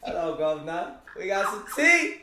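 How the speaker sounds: playful mock greeting. Playful greeting demanding gossip.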